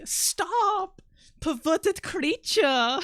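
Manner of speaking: high, drawn-out mock French accent; French woman impression. Streamer imitating a French woman from a video, mock French accent. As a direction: scold like an outraged French woman. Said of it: "that one French woman that's like".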